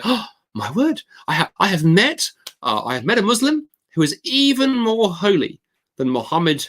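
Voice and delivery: high, exaggerated astonishment; mock amazement. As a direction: feign awe at meeting someone so holy. Friend feigns amazement at a holy man.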